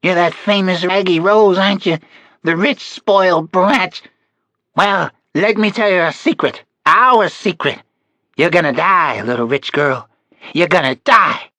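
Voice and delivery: high, loud sneering crone; menacing old woman. A narrator's reading. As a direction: taunt her with cruel glee. Old kidnapper taunts the child.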